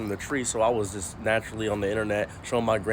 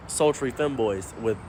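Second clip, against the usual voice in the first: normal talking voice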